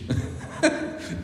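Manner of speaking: short breathy laugh at the punchline; real laugh. Real, not acted. Pastor laughs to the congregation, short laugh.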